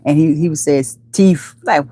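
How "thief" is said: "Teeth" said with a hard T and final f, teef; Jamaican pronunciation. Retold or imitated. Showing how Red says thief, "Teeth" as Jamaican teef. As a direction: imitate Jamaican "Teeth", t in place of th. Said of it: "he has that Jamaican accent".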